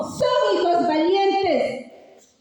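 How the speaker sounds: slow, ringing words of pride; proud declaration. Acted. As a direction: declare it proudly to the whole crowd. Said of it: "Con orgullo yo les digo".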